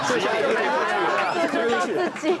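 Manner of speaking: high, very slow drawn-out repetition; ominous slow repeat. Retold or imitated. Telling the host the patients were wheeled away, slow and drawn out. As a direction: stretch each word out for drama.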